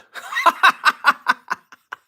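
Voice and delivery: loud high burst of laughter; real amused laugh; big laugh. Real, not acted. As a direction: crack up out loud at the bad beat. Laughs at the lucky cover.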